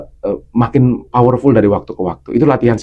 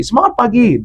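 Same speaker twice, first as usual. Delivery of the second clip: much higher, bright pep call; energetic greeting; 9 semitones higher than usual. Shown as a demonstration. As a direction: cheer the audience awake with a lifted voice. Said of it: "dia mainkan intonasi".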